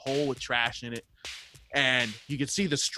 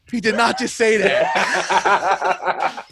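high, loud, drawn-out mock horror; imitated shocked disbelief; 7 semitones higher, 8 dB louder, much slower than usual. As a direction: stretch it out slowly, aghast and laughing. Friend's shocked reaction, acted.

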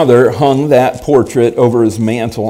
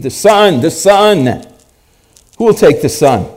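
auctioneer calling out; 7 semitones higher, 4 dB louder than usual. Acted.

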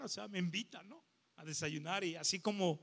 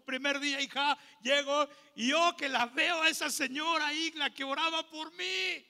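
higher and louder, rising to a climax; building excitement; 7 semitones higher, 5 dB louder than usual. Real, not acted. Preacher tells the congregation he met the praying neighbor, rising in excitement. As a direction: swell the voice as the big reveal lands.

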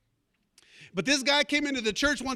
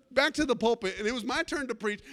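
ordinary delivery

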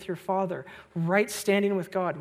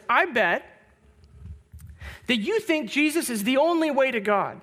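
higher, louder imitated challenge; accusatory; 5 semitones higher, 4 dB louder than usual. Student confronting a visiting pastor in class, accusatory. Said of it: "in kind of an accusatory tone".

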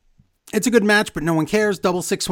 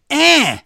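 short, high, loud yelp; imitated attack cry; mock attack yell; 10 semitones higher, 6 dB louder than usual. Liv goes and attacks Becky, short mock yell.

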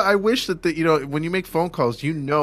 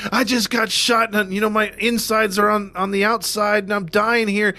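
mock dying caller; 8 semitones higher than usual. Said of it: "the person could be like".

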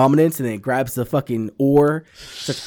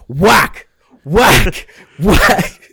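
whack sound effects; 6 semitones higher, 6 dB louder, much slower than usual. Retold or imitated. Retelling the armorer beating troopers.

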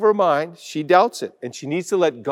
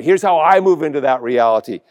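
normal talking voice

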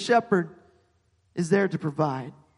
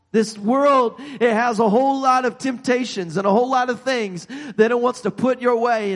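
louder, urgent sermon warning; preaching at full force; 4 semitones higher, 4 dB louder than usual. Acted. Preacher warning the church about the world's temptations, forceful. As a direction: warn the flock hard.

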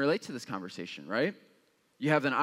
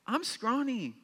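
higher, self-deprecating whine; self-mocking whine; 10 semitones higher than usual. Pastor joking about his photo, high self-mocking whine. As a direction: laugh at your own skinny arms.

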